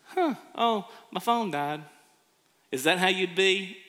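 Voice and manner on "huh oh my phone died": casual shrug. Acted. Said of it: "and you're like".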